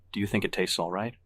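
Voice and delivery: usual speaking voice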